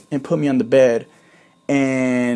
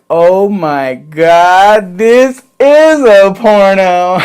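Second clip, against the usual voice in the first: much louder, higher and very slow, stretched out in amazement; excited exclamation; 5 semitones higher, 11 dB louder, much slower than usual. Retold or imitated. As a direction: howl it in delighted disbelief. Realizing the scene turns sexual.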